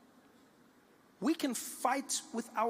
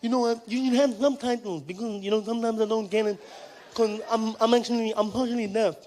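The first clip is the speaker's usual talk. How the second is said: deaf fan voice; 4 semitones higher, 6 dB louder than usual. Retold or imitated. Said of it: "He said".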